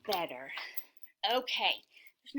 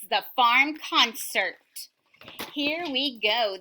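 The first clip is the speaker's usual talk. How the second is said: ordinary delivery, nothing marked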